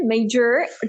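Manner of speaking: मेजर in an American accent, ending on a sounded r. Shown as a demonstration. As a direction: model the American pronunciation of मेजर. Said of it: "So American में क्या कहते हैं?". (अमेरिकी लहजे में मेजर, र साफ़)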